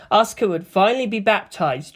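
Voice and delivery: ordinary delivery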